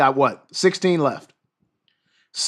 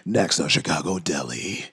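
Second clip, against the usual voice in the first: very low, slow announcer; hammed-up deep trailer voice teasing the next episode; TV promo voice; 12 semitones lower, slower than usual. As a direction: intone it like a dramatic TV promo. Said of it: "I could do that with this voice".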